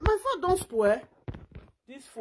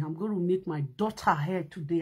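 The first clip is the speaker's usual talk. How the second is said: usual speaking voice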